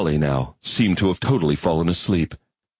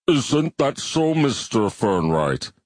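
slow, measured alien voice with a different timbre; slow alien bivalve; 5 semitones higher, slower than usual. Acted. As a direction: speak as a calm, ponderous shellfish creature.

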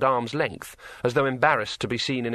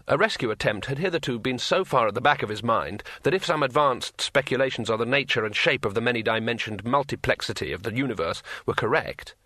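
ordinary delivery

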